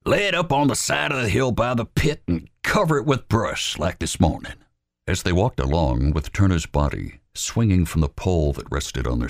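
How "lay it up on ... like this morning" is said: Wallace's voice. Acted. Wallace putting Albert in charge, commanding. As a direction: lay out the orders firmly.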